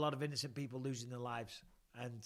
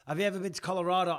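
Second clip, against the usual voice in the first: ordinary talk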